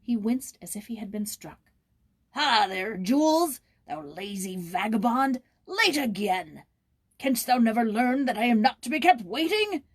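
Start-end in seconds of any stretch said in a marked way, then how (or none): 2.3-9.8 put-on loud, higher, angry bellow at the boy; gruff bellowing caretaker. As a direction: roar at the lazy boy from down the lane. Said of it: "a gruff voice bellowing at him".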